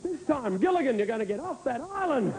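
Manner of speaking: high, eager plea to the TV; urging the screen on. Retold or imitated. Urging the TV show on.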